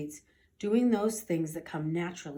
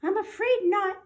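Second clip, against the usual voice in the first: high squeaky professor; professor mouse; 13 semitones higher, 4 dB louder than usual. Acted. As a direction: address the room like a tiny scholar. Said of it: "said Professor O'Hare".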